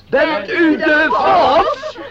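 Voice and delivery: slow, drawn-out teasing question with a laugh; mock question to a stranger. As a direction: drawl it out playfully, half laughing. Host acts out asking a walker. (langzaam en plagerig gevraagd, met een lach)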